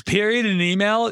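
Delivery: ordinary talk, nothing marked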